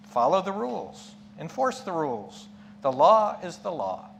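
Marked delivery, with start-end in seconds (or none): none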